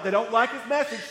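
normal talking voice

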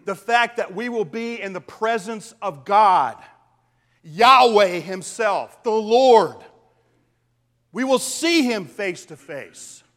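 preacher swelling on the Lord's name; rising preaching fervor. Real, not acted. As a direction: build to a ringing proclamation. Preacher building on God's presence.

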